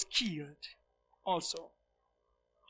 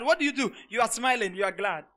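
ordinary delivery

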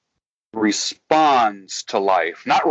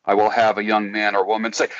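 normal talking voice

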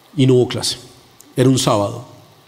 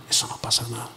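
deceiving voice, soft; 4 semitones lower, 12 dB quieter, faster than usual. Retold or imitated. Spirits of deceit speaking.

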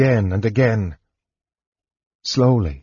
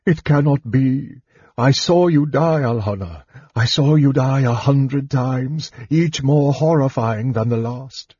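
high, strained, distraught father; tormented, grief-struck elf king; anguished old king; 4 semitones higher than usual. A narrator's reading. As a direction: pour out horror and disbelief in a high voice. Tormented king tells his daughter.

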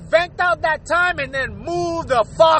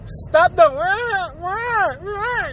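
mock crying; 4 semitones higher, much slower than usual. Mocking people who cry online.

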